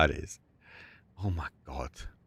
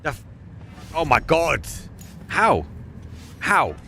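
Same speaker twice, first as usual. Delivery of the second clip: incredulous; 2 semitones higher than usual. Real, not acted. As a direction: ask it in stunned disbelief. Caster stunned by play.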